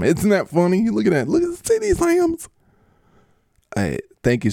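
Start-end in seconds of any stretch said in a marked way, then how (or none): 0.0-2.5 rising to an eager falsetto; C D Lamb. Acted. C D Lamb urges the host to look, climbing squeal. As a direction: climb higher with each urge to look. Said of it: "I'm C D Lamb".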